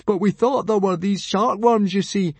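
high, anxious excuse; cowed, high-voiced explaining; flustered stammer. A narrator's reading. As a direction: plead your case nervously, voice up. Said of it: "stammered Fishlegs".

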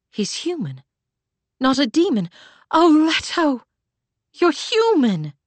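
high, rising joyful cry; excited. A narrator's reading. Joyful recognition of grandson.

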